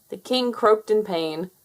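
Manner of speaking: ordinary delivery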